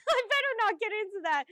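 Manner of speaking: high, loud words through laughter; loud laughing self-check. Real, not acted. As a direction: laugh off an outburst while talking. Laughing off own rant.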